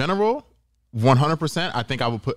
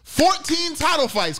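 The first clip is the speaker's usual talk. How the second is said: excited; 14 semitones higher, slower than usual. Real, not acted. Counting title fights, exclaiming.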